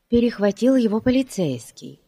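plain reading voice

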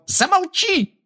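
very high-pitched squeal of fury; piercingly high, outraged screech; shriek. A narrator's reading. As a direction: shriek to cut someone off. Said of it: "взвизгнула Белатриса".

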